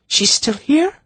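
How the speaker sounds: put-on high surprised young girl's cry; exclaiming. A narrator's reading. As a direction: blurt it out in shock. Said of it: "Zinedra exclaimed".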